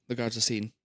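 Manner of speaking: usual speaking voice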